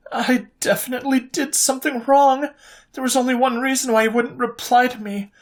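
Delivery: high, anxious, spiralling self-doubt; anxious young woman doubting herself; anxious inner voice. A narrator's reading. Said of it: "her anxiety had hit an all-time high".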